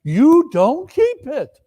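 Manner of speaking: high, insistent protest; earnest nephew. Acted. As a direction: press the point hard. Nephew argues.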